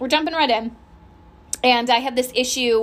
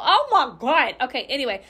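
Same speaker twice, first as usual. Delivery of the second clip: ordinary talk, nothing marked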